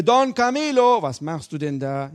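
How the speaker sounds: a sudden high call, then drop; the inner voice calling out a name. Pretend. Voice of conscience calling out.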